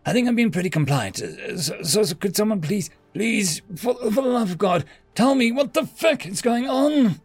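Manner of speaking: high, stammering desperate demand; frustrated begging, climbing in pitch; exasperated plea. Acted. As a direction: build from halting protest to high outburst. Held captive, begs for answers.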